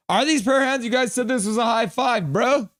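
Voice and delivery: high, loud incredulous outburst; indignant disbelief. Real, not acted. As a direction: challenge them in high-pitched protest. Mock outrage about the emoji.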